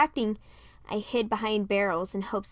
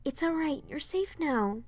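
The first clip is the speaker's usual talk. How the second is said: higher, soft, comforting; warm reassurance; 6 semitones higher, 3 dB quieter than usual. A narrator's reading. Rapunzel calms the frightened girl, in a soft reassuring voice. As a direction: soothe a scared child. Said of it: "She gave a warm smile".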